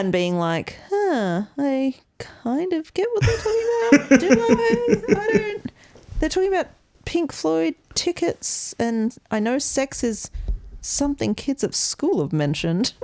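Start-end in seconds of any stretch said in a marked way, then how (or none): 0.9-4.2 high-pitched, hammed-up confused child; puzzled kid. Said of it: "watching it as a kid and being like". 4.2-12.9 mock a kid working it out, unsure; puzzled kid. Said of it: "watching it as a kid and being like".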